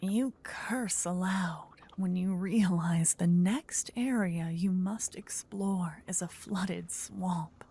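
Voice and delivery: ordinary delivery, nothing marked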